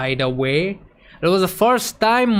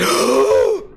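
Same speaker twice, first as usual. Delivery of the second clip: startled gasp; 7 semitones higher, 4 dB louder than usual. Retold or imitated. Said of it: "he was like".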